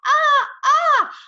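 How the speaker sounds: high, loud screams in bursts; piercingly high short screams; scariest scream. A narrator's reading. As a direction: scream to make someone jump. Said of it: "I kept on screaming".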